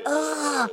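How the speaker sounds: high, drawn-out mock wail; anguished cry. Told to stay in her own skin, mock anguished wail. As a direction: let out a high wail of discomfort. Voices her reaction to staying put.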